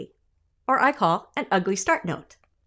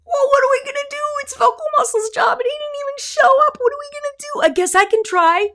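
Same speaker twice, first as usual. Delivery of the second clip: much higher, louder cartoon voice of air; air as a character; 4 dB louder than usual. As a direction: play the air, flustered and unsure. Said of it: "Air says".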